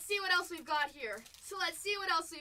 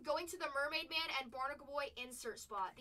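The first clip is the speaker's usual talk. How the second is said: ordinary talk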